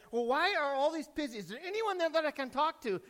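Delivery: high-pitched, insistent questioning; telemarketer caller. Acted. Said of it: "And she asked".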